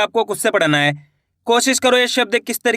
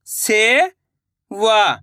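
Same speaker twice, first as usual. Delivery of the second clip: सेवा drawn out slowly, syllable by syllable; slow syllable split; much slower than usual. Shown as a demonstration. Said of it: "मैं किस तरीके से".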